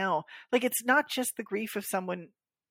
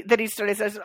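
ordinary talk

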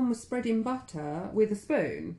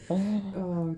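ordinary talk, nothing marked

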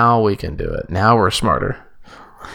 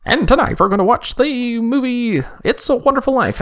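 high, bright vintage radio announcer; old-timey radio voice. Pretend. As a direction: announce it like a 1940s radio host. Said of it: "the old-timey radio voice there".

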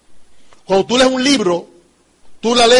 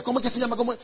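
much softer, half to himself; embarrassed mutter; 11 dB quieter, faster than usual. Retold or imitated. Man who forgot his old friend's name, softly muttering to himself. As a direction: mumble it under your breath, embarrassed.

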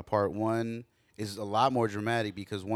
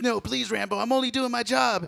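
much higher whiny plea; mock-scared office guy pleading with Rambo; 12 semitones higher, 4 dB louder than usual. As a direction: beg for your life, hammed-up. Pleading movie character.

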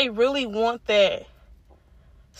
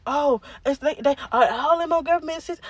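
ordinary talk

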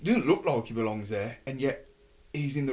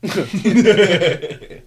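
laughing; 6 semitones higher, 13 dB louder than usual. Real, not acted. Laughing, drawn out.

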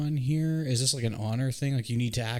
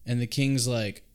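ordinary talk, nothing marked

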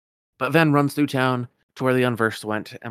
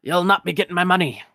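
higher, louder Scottish miser voice; indignant old Scotsman, raised and rolling; Scrooge McDuck; 7 semitones higher, 7 dB louder than usual. Acted.

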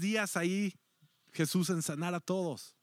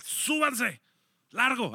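higher, louder command; firm order; 7 semitones higher, 4 dB louder, slower than usual. Acted. Jesus orders his disciples into the boat, firm and loud. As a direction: send them off firmly.

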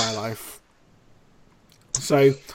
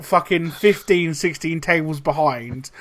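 usual speaking voice

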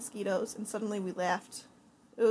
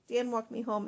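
ordinary delivery

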